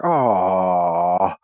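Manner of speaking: low, let-down "Aw."; mock sulky aw. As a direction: sulk like a kid denied candy. Disappointed Spider-Man.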